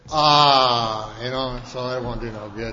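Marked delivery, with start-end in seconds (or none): 0.1-1.2 a loud, drawn-out "Ah" from the crowd; groaning "ah". Retold or imitated. Imitating his home group's collective groan, loud and knowing. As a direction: groan it the way the whole group would. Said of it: "everybody go".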